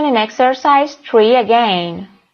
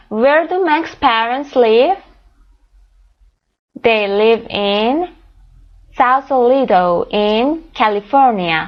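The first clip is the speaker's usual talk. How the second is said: slow reading; much slower than usual. Shown as a demonstration. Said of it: "teacher repeats slowly".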